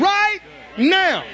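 right now, high and loud; loud emphatic call. Real, not acted. Preacher urging the congregation to believe right now, loud. Repeated phrase, now shouted.

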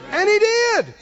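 high, loud triumph; preaching. Real, not acted. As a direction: declare it in triumph. Preaching at full force.

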